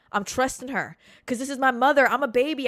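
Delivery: normal talking voice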